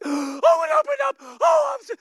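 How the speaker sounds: gasp, then high loud amazed cry; hammed-up astonishment that it worked; mock shock. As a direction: believers caught off guard by answered prayer, shrieking surprise. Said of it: "we're shocked".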